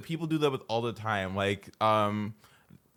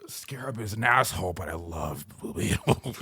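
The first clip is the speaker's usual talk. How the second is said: hushed whisper; 4 semitones lower than usual. Real, not acted. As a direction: whisper it like a secret. Aside about character.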